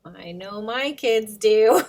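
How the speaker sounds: ordinary delivery, nothing marked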